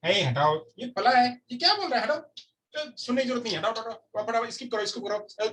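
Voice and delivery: high-pitched mock impatience; dismissive ignoring listener. Teacher imitates a viewer skipping the lesson, dismissive and impatient. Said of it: "ignoring listening क्या है".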